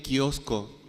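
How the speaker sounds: "kiosco" stretched out slowly, with a clear hard k; kiosco pronounced slowly. Shown as a demonstration. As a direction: slowly spell out the sound of "kiosco". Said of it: "suena lo mismo la C de casa". ("kiosco" pronunciado despacio con k marcada)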